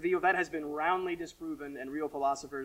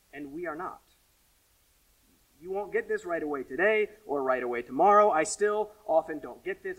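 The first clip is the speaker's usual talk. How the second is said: ordinary talk, nothing marked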